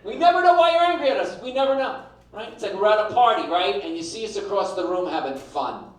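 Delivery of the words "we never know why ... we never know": exasperated. Acted. Exasperated complaint to women.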